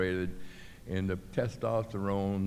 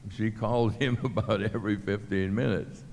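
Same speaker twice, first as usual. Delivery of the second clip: ordinary talk, nothing marked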